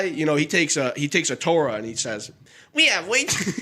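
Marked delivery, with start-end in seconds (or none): 2.7-3.6 a half-hearted rabbi voice that collapses into laughter; rabbi voice cracking up. Retold or imitated. The teller voicing the rabbi breaks into laughter mid-joke. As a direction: start the accent, then break up laughing. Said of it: "commit, commit, dude".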